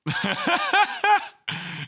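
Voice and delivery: loud high squeal of laughter; high shrieking laugh. Real, not acted.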